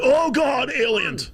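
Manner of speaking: high shrieking mock panic about aliens. Streamer mocking Tucker Carlson's opener as shrieking mock panic. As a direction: squeal it like a hysterical pundit. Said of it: "He's gonna be like".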